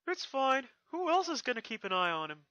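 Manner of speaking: put-on high, easy-going boy voice; cheerful dragon. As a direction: shrug it off. Spike reassures.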